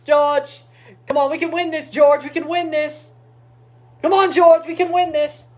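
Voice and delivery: high, loud rallying shouts; urgent cheering. Real, not acted. Fan begging George to win this, urgent cheering. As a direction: yell encouragement at the batter.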